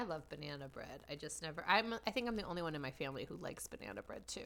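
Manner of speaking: quick, breathy whispered aside; whispering. Real, not acted. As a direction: whisper it fast, like a confession. Unvoiced aside about family.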